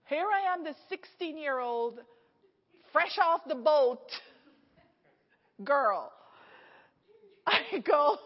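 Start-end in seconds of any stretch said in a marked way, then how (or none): none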